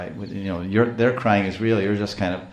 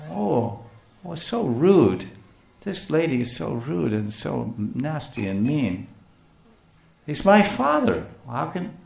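put-on upset little boy, higher voice; retold hurt child; 6 semitones higher, slower than usual. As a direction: whine like a wounded little boy, lightly acted. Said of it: "he was very unhappy and crying".

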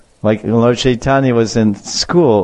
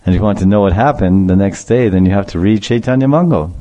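ordinary talk

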